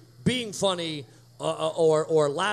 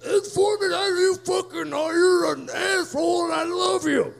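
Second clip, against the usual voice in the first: heckler; 12 semitones higher than usual. Retold or imitated. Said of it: "and he'd go".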